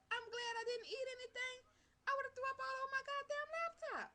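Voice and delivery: high, loud retold disgust; grossed-out exclamation. Vlogger recalling nearly throwing up over a laptop, loudly disgusted. Retelling disgusted reaction to video.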